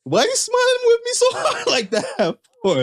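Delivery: very high, comic squeal of a question; mock bewilderment. Married man reacting to a stranger's wide smile, mock-baffled squeal. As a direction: squeak it out, half laughing.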